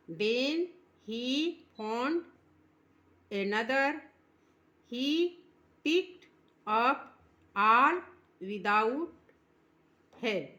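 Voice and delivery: slow, drawn-out recitation; slow poem reading. Shown as a demonstration. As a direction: read each word slowly for the class. (धीमा कविता पाठ)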